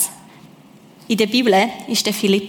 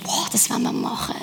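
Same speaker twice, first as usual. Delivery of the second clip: whispered; 6 dB quieter than usual. Real, not acted. Aside after a hopeful story.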